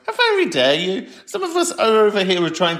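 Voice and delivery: high, arch, indignant "how very dare you"; mock outrage. Telling off playground kids, mock indignant. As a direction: camp telling-off of the kids outside.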